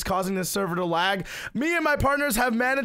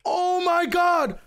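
stunned; 10 semitones higher, 3 dB louder, much slower than usual. Real, not acted. Reacting to the win.

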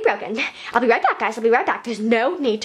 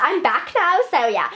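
ordinary talk, nothing marked

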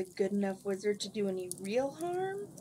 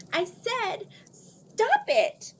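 much higher, much louder yell; shouting; 14 semitones higher, 8 dB louder, much slower than usual. A narrator's reading. Alienora yells at the wizard as she throws the bucket. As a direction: scream it at him. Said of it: "Alienora shouted".

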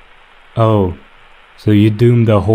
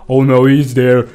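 ordinary talk, nothing marked

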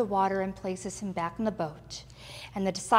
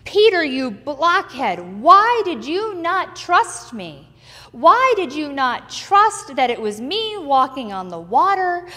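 shaming scold; 7 dB louder than usual. Acted. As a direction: berate him like a stern teacher. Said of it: "shaming Peter for his lack of faith".

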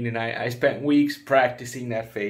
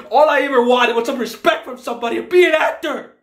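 high fast shout of grievance; anguished shout; 14 semitones higher, 13 dB louder, faster than usual. Acted. Failed actor screams his grievance at the star. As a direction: scream out a lifetime of hurt.

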